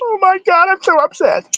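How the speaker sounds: very high, whiny fake distress; hammed-up falsetto meltdown; mock upset. Host mocking her getting upset on stream, fake distress. Mocks the upset woman in clip.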